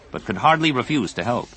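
usual speaking voice

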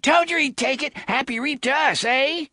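put-on high, loud gloating boy; jeering. Bully gloating after the dog dies, loud jeering. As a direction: rub it in their faces. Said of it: "the first boy jeered".

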